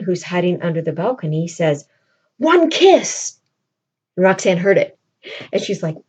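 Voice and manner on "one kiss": higher, put-on young man's voice; mock eager suitor. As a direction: blurt the request from below.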